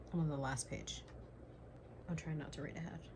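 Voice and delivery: hushed, breathless whisper; tense whisper. Real, not acted. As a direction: whisper it in suspense. Tense final page.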